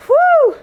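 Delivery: high excited whoop; woo cheer. Real, not acted. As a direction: let out a high whoop of effort. Cheering during the counted reps.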